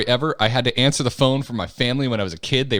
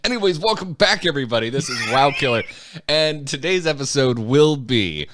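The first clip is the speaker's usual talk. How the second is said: higher, bright host opener; podcast host intro; 4 semitones higher than usual. Acted. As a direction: kick off the show like a radio host. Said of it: "the voice drops".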